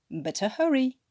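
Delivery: ordinary delivery, nothing marked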